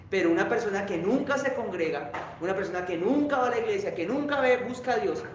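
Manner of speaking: normal talking voice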